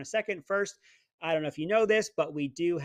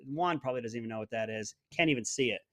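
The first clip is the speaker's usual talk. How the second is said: normal talking voice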